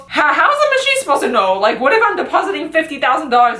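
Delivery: high and fast, fussing and disbelieving; mock an outraged customer who doubts the machine; indignant old man. Old man argues with the worker about the ATM, fast and indignant.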